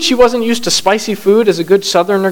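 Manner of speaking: ordinary delivery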